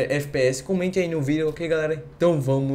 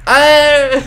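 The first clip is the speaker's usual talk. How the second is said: excited shout; 9 semitones higher, 11 dB louder than usual. Real, not acted. Celebrating a kill in game.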